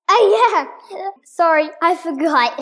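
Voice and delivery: high, loud scream. Acted. Two girls screaming an apology at the author.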